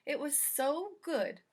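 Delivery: usual speaking voice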